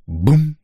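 short, high imitated mortar thump; hollow boom. A narrator's reading. Narrator mimics the pipe firing a grenade, booming. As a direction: voice the launcher going off. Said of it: "гулко сказала труба".

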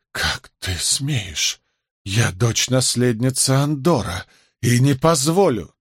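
ordinary delivery, nothing marked

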